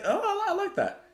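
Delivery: light up at the idea; delighted. Real, not acted. Delighted reaction to song meaning.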